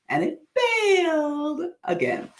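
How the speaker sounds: high, long-drawn wail of dismay; mock wail. Real, not acted. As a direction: stretch the last word way up high. Mock lament over repeated failure.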